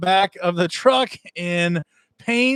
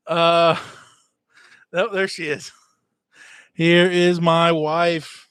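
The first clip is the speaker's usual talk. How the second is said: usual speaking voice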